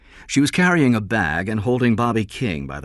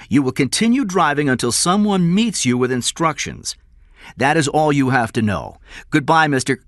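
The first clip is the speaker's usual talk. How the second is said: loud, raised, final orders; commanding; 9 semitones higher, 6 dB louder than usual. Acted. Kidnapper finishing ransom instructions by phone, commanding. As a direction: close the matter firmly.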